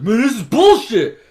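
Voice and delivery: loud indignant complaint; angry outburst. Retold or imitated. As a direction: vent loudly. Character angry after being warned off.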